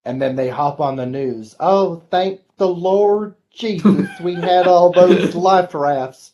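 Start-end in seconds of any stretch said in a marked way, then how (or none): 1.6-6.3 slow, pious mock-grateful voice; mock newscaster. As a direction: drag it out like a smug TV anchor thanking God. Mocking newscaster after tornado.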